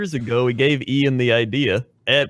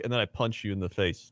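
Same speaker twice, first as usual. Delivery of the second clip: ordinary talk, nothing marked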